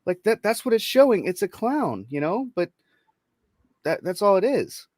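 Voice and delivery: arguing with the HR lady, high and incredulous; incredulous mock protest. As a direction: act baffled at the complaint. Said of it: "And I'm like".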